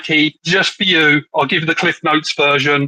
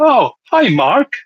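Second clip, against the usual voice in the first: high, swooping movie-quote impression in The Room accent; The Room accent, exaggerated swoops on each phrase; 6 semitones higher, slower than usual. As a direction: put on The Room accent, pitch diving and rising. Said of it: "I was gonna try and do that accent".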